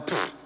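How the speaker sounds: soft scoffing puff of air; dismissive pfft. Pretend. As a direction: brush off the advice with a pfft. Dismissing the GPS.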